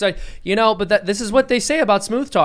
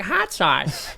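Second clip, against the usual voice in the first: Hillary on the radio in a put-on Southern accent; mock Southern accent. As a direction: ham up a fake Southern accent. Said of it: "put on a Southern accent".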